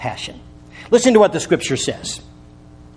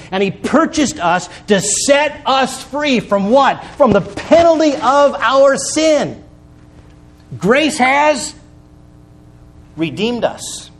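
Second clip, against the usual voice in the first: much higher, slow, ringing pulpit call; preaching at full force; 7 semitones higher, slower than usual. Acted. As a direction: drive the point home from the pulpit. Preacher climaxes on redemption.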